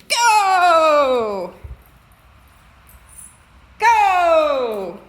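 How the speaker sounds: loud, long drawn-out shout; shouted send-off. Real, not acted. Owner releasing the dog into snow, long shout. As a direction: stretch the word into a big cheering yell.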